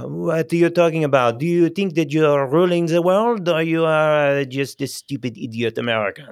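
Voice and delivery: loud, high, scornful French sneer; mock-indignant Frenchman scoffing at an American; outraged French accent, hammed-up. Shown as a demonstration.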